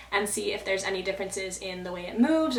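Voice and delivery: ordinary talk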